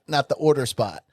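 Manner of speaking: normal talking voice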